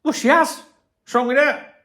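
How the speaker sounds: high, loud, cheeky comeback; cheeky defiant retort. Retold or imitated. Vlogger answering his partner, high cheeky retort. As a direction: toss it back with a grin. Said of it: "I'd turn around and go".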